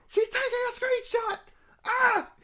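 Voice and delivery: very high, loud shriek of joy; ecstatic shriek. Retold or imitated. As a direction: lose it completely with delight. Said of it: "and I'm like".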